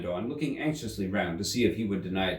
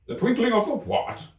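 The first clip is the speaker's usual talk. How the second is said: higher baffled royal voice; puzzled King; 3 dB louder than usual. Acted. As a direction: demand it back, confused.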